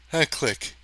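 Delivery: ordinary delivery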